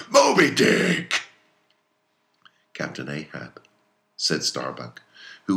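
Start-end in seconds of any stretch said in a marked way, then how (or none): 0.1-1.2 high, loud, drawn-out holler; high yell. Acted. Repeats the name, climactic cry. 2.7-3.6 softly voiced, low-key appeal; cautious address. Starbuck carefully addresses Captain Ahab, quiet.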